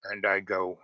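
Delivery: ordinary talk, nothing marked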